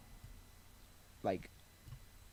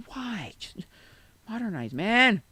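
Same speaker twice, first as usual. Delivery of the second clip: high, exasperated mock complaint; imagined exasperation; 7 semitones higher, 4 dB louder, much slower than usual. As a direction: voice their fed-up thought, pitched up. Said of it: "they were definitely probably thinking".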